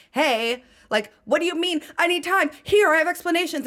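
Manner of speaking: frantic. Retold or imitated. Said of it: "is like".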